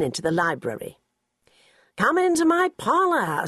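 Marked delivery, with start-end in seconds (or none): 2.0-3.4 high, sly, playful invitation; spider to the fly. Acted. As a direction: lure her in like the spider. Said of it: "said the spider to the fly".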